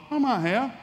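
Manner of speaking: high, guilty little admission; high, sheepish half-confession; sheepish son. Acted. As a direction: squeak it out like a kid caught red-handed. Son admits sin to God.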